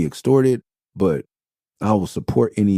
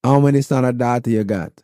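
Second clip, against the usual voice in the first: repeated in a Jamaican lilt, pitched higher; Jamaican patois accent; 7 semitones higher than usual. Retold or imitated. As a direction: imitate the Jamaican man asking again. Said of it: "I said, "What?".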